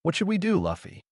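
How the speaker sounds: high-pitched helpless question, falling away on the name; lightly voiced helplessness, starting high and dropping; helpless plea. A narrator's reading. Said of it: "Nami helplessly said".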